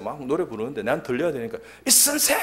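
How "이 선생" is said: very high-pitched imitated call. Colleague calls again over the singing, high mock yell. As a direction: shout for someone over loud singing.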